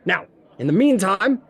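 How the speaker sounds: hearty, inquisitive character voice; lightly voiced tavern host. Froome questions the party over drinks, hearty host. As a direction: hold court with the newcomers.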